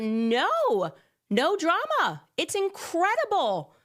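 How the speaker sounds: mock amazement. Mock astonishment at no ban.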